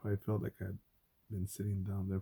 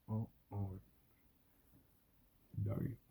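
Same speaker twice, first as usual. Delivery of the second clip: ordinary talk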